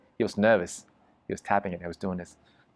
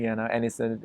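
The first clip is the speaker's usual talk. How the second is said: normal talking voice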